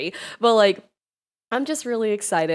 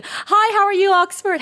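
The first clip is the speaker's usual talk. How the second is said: high, loud, bright hello to a viewer; excited greeting; 12 semitones higher, 7 dB louder than usual. Real, not acted. Streamer greeting a chat viewer, excitedly high and loud. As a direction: squeal hello at a friend in chat.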